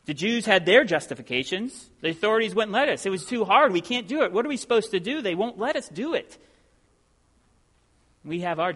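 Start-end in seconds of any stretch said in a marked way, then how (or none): 2.0-6.4 higher-pitched, protesting voice with sing-song swoops; mock whining protest; complaining excuses.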